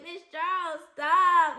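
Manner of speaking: high-pitched mock student plea; imitated protesting student. Students reacting to their silly teacher, imitated high-pitched protest. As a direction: mimic a kid begging the teacher to stop.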